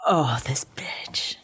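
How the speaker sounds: slow, hushed contempt; drawn-out voiceless outrage; disgusted whisper. Real, not acted. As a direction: whisper it under the breath, appalled. Disgusted reaction to retold denial.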